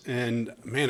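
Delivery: usual speaking voice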